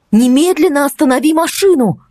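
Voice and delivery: almost shout the order; near shout. Acted. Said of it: "Практически крикнула я". (почти крик)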